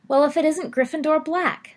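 high, loud sneering taunt. Acted. Rosier sneering at Sirius in the corridor, mocking. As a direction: sneer it down at him. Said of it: "sneered Evan Rosier".

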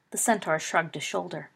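plain reading voice

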